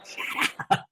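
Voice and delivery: breathy whisper under the breath; whispered shut up. Retold or imitated. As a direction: hiss it so only they hear. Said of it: "I was like".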